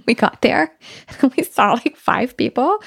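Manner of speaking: real laughter through the words; giggling retelling; laughing. Real, not acted. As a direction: laugh as you tell it. Laughing while retelling outing.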